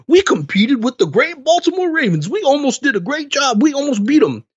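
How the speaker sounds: high, whiny mock pride; mocking players. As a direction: parody the players patting themselves on the back. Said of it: "players ... saying that after the game".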